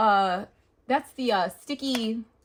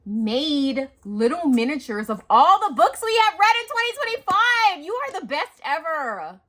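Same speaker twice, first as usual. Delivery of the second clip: louder and rising to a high squeal; excited; 7 semitones higher, 9 dB louder than usual. Real, not acted. Praising her friend's miniatures to viewers, rising excitement. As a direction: build into a delighted squeal. Gushing about friend's craft.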